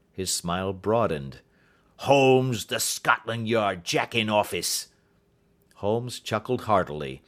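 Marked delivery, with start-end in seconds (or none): 2.0-4.9 slow sneering insult. A narrator's reading. Roylott taunting Holmes, slow and sneering. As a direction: drag out each scornful word.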